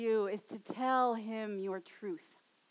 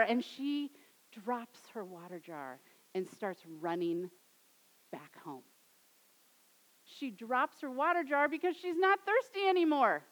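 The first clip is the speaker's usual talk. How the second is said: usual speaking voice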